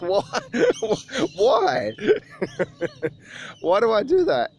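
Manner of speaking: high, laughing why why; giggling mock outrage; laughing disbelief. Laughing disbelief at gym owner.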